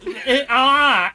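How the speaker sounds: high, loud, drawn-out words through laughter; laughing quote. Retold or imitated. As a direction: shout the echo while cracking up.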